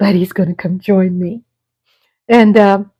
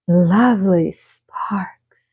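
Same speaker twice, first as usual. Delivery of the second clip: slow, whispery show intro; podcast catchphrase; 5 dB quieter, much slower than usual. Real, not acted. Host announces her show to viewers, slow breathy catchphrase. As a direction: breathe out the show name, drawn way out.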